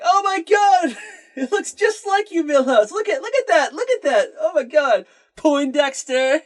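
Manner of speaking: very high, hammed-up laughing taunt; high-pitched mock hysterics, barely holding together; gleeful mocking. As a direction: imitate a big oaf squealing with laughter at someone. Homer mocks Milhouse with glee.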